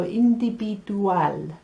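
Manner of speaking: "individual" said as indi-bi-du-AL. Shown as a demonstration. Teacher showing the B sound, Spanish "individual". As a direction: soften the V of "individual" into a B. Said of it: "makes a B sound".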